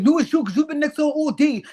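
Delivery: high, loud, defensive comeback. Retold or imitated. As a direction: imitate someone throwing the blame straight back. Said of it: "Biggie was like".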